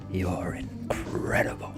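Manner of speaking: breathy romantic whisper. Acted. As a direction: whisper it close to her ear. Whispered compliment to Carmen.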